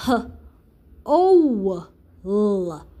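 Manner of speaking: "h", "o", "l" said one by one, spaced out; sounding out letters. Shown as a demonstration. Teacher sounding out the word whole for pupils. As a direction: break "whole" into its sounds for kids. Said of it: "So this word is".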